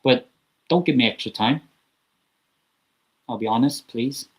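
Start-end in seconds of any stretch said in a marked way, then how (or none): none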